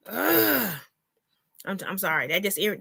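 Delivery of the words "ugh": high, exasperated "ugh"; irritated groan. Real, not acted. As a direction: groan out the frustration. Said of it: "that irritates me".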